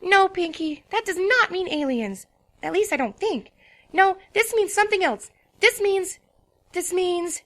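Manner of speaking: patiently setting a friend straight; insistent lecturing pony; Twilight explaining. A narrator's reading. Twilight correcting Pinkie.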